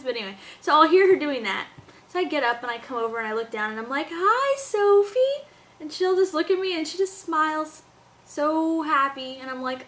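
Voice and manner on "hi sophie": high, slow singsong hello; baby-talk greeting. Retold or imitated. Mom greeting her waking baby, high baby talk. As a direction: coo a drawn-out hello to a baby.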